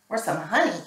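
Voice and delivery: usual speaking voice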